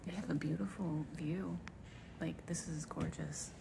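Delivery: soft unvoiced whisper. Real, not acted. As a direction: whisper so no one hears. Quiet place, whispering.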